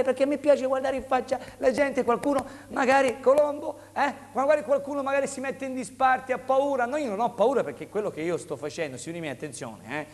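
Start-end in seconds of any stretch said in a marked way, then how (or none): 0.0-7.6 high, lively sales patter; rally the viewers like a TV seller; infomercial. Real, not acted. Host addresses camera, pitching.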